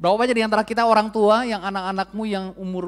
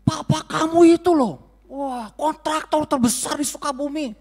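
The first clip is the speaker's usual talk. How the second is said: higher, louder mock jab at a child; preacher mocking the wrong way to rebuke a child, higher and louder; mock scolding; 5 semitones higher, 4 dB louder than usual. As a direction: tease the kid about his father, hammed-up. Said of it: "tegur anaknya Pak Willy gini".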